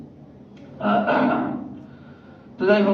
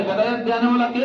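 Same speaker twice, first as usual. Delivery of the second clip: higher, faster rallying question; rousing call; 9 semitones higher than usual. Real, not acted. Preacher prompting congregation to answer "Allah", rousing call. Repeats question for louder response.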